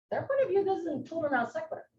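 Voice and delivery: ordinary delivery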